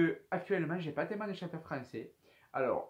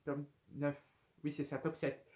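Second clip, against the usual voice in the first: ordinary delivery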